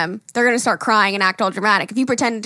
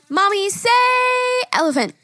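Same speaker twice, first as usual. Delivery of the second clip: high-pitched pretend little kid; little girl; 13 semitones higher than usual. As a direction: imitate a girl coaxing her mother. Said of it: "the little girl, like, said".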